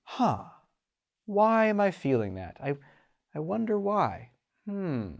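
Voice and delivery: soft, wondering, musing why; quiet curious musing; open curiosity. Shown as a demonstration. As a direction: ponder it gently, genuinely curious. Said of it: "It's rarely one of open curiosity".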